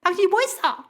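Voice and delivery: high-pitched mock challenge; accusing retort. Spouses quarrelling over worship time, high accusing retort. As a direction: act out a spouse throwing blame back.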